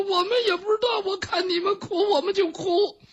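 high comic blubbering; mock tearful, clueless crying; sobbing maids. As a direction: wail it helplessly in a high voice. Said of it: "他们在那抽抽搭搭的哭".

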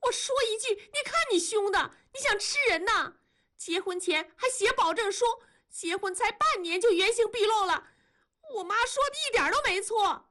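very high, fast acted tirade; indignant yelling. A narrator's reading. Wife hurls a pillow and yells at husband, high indignant tirade. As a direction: rattle off complaints at a piercing high pitch. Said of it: "喊".

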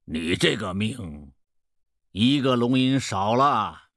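slow, drawn-out fortune-teller; old peddler, haggling. Acted. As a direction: stretch it out knowingly.

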